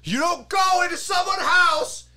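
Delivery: very high mock screaming; high, strained imitation of a screamer; retold scream. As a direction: act out a screamed scolding. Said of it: "he's screaming at them".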